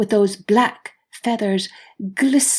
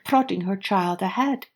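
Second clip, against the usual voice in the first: usual speaking voice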